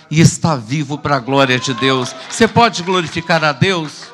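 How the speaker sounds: loud testimony climax; rousing preaching. Real, not acted. Preacher urging the congregation to glorify God, loud and rousing. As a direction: lift the volume and call for praise.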